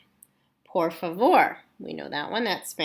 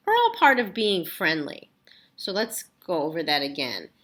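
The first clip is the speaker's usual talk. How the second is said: ordinary delivery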